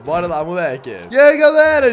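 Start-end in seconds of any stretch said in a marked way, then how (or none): 1.1-1.9 loud hype greeting. Real, not acted. Greeting the crowd in game.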